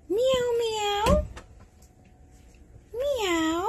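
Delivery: high run of meows; playful human meowing; cat imitation. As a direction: mimic a kitty in a high voice.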